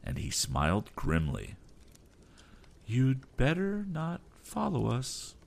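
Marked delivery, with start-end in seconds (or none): 2.9-5.3 slow, firm threat; captain's warning. Acted. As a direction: lay down the final warning slowly.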